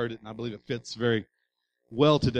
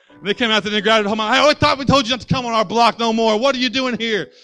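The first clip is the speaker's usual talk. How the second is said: louder, higher, fast mock bully threat; 9 semitones higher, 10 dB louder than usual. Bullies threatening the kid again, loud and high. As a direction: jeer like older bullies.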